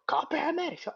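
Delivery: high, worried mom; a mother telling him what to do. Retold or imitated. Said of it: "like a mom does".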